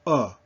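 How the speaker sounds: a short, open French 'O'. Shown as a demonstration. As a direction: drop the jaw slightly on O, like aw. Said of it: "little bit more open".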